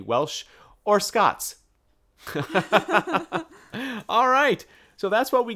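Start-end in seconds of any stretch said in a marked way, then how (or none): 2.2-3.4 bright rising laugh at own botched accent. Real, not acted. Laughs after apologising to the Welsh and Scots, bright laugh.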